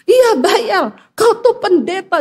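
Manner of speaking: high-pitched mock scolding; demanding debt collector. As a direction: imitate a collector's insistent demand. Acted debt collector demanding payment.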